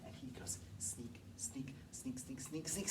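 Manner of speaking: hushed, unvoiced sneaky whisper; a creeping wolf whispered to the class; whispered sneaking. A narrator's reading.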